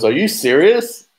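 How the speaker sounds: can't believe what he heard; incredulous. Retold or imitated. Manager to table, mock incredulous disbelief.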